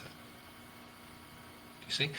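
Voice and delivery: usual speaking voice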